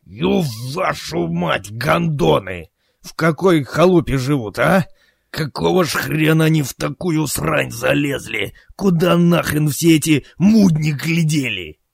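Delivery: high, exasperated captain's tirade; angry cursing. Acted. Captain curses the filthy hideout they drove to, in an angry rant.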